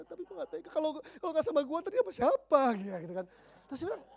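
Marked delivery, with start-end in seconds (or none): 0.1-2.6 high, mock-whiny girl, building louder; exaggerated high-pitched pleading; typical girl. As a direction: mimic a lovesick girl fretting. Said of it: "Biasa kan, cewek-cewek gitu kan".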